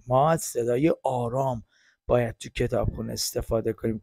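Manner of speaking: normal talking voice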